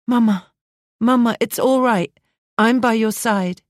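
high, tearful daughter's plea. Acted. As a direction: comfort her through tears. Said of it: "The tearful voice of her daughter".

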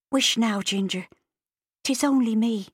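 calm the horse gently; young Irish girl. A narrator's reading. Bridie soothes the horse.

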